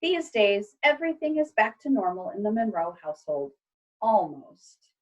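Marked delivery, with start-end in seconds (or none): none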